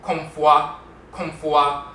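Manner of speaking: Komfwa with the o gliding into wa; Asante Komfwa. Shown as a demonstration. Teacher repeating the Asante form Komfwa. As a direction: Komfwa clearly spelled out as kom-fwa. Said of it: "becomes Komfwa".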